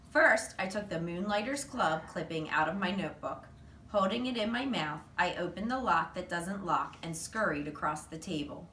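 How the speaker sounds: ordinary delivery, nothing marked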